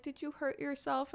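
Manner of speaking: put-on high, soft little-kid question; toddler asking gently. Mom imitating her toddler asking if she's hurt, high soft child voice. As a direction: softly imitate a caring small child.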